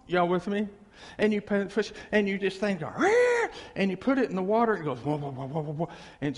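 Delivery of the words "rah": sudden high roar like a motor starting; mock motor roar. Preacher mimics a motor starting. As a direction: rev it up with a shout.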